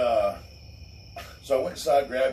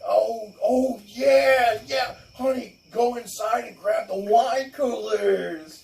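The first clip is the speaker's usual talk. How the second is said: higher, eager mock neighbor, slow at the end; 10 semitones higher, 3 dB louder, slower than usual. Neighbor offering wine coolers, mocked higher and slower. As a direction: ham up the flustered neighbor. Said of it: "they're like".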